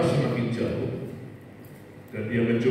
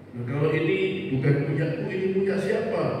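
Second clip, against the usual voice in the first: higher, puzzled questioning voice; bewildered plea; 6 semitones higher than usual. Acted. Man puzzled by footprints asking God, high bewildered question. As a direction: ask high and confused, not believing it.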